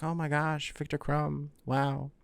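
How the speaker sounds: soft, dreamy fangirl gush; lightly acted starstruck sigh; mock swoon. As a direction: swoon over a crush under the breath. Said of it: "And she swoons".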